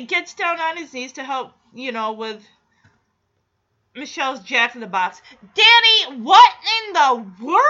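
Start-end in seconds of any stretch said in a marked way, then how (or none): 5.5-7.6 loud, higher cry of disbelief; exasperated exclamation. Real, not acted. Host scolding Danny over the car part, exasperated outburst. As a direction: call him out, baffled.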